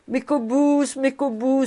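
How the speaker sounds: high, pleading wail of protest; piercing, pathetic. A narrator's reading. Old rabbi begging Cobus for the loan, pathetic. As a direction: plead piercingly, pitched well up. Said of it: "d'une voix perçante et pathétique".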